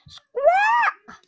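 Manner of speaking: shrill high squawk, loud; a hen squawking in alarm; loud high-pitched bird squawk; hen's squawk. A narrator's reading.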